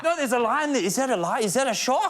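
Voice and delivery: high-pitched, hammed-up questioning; mock film character. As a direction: play a foolishly curious horror victim way up high. Mimics foolish horror film characters.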